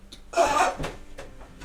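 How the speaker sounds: sudden high unvoiced gasp of shock; sharp gasp. A narrator's reading. Game master gasping as the bolt hits, sharp gasp. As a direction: suck in air as the bolt flies. Narrator builds suspense in scene.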